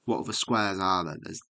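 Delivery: ordinary talk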